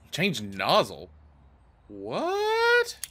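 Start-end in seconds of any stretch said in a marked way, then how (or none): 1.9-3.0 very high, drawn-out what; incredulous rising squeal; baffled surprise. Real, not acted. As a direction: stretch it upward into a squeal of disbelief. Baffled by the nozzle change.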